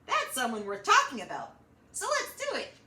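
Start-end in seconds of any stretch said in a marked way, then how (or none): none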